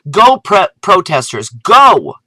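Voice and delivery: loud, high rallying cheer. Real, not acted. Cheering on protesters at Monsanto, loud cheer. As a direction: cheer them on like a fan.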